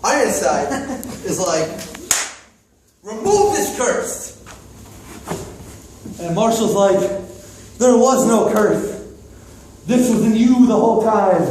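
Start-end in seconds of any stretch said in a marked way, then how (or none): 3.0-4.3 higher, slow, pleading command; high demand. Acted. Prince asking Marshall to lift the curse, high and slow. 7.8-11.4 wise mentor. Acted. Marshall gives wise advice.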